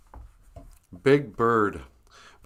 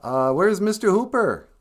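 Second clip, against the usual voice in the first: higher, innocent puppet question; Big Bird; 8 semitones higher, 4 dB louder than usual. Retold or imitated. Big Bird looking for his missing friend, higher puppet voice. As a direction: ask brightly, not knowing the bad news. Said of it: "And he goes".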